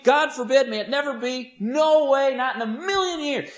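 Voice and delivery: high, insistent string of refusals; emphatic negation. Acted. Preacher renders Peter's Greek refusal, most emphatic. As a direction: pile up the refusals, punching each one. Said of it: "the strongest, most emphatic".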